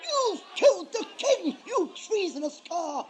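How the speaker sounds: loud, enraged accusation; furious outburst. Acted. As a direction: bellow at the traitors. Accusing the traitors of regicide.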